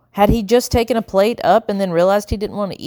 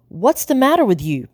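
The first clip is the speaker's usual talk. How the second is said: demanding; 6 semitones higher than usual. A narrator's reading. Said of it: "Blue demanded".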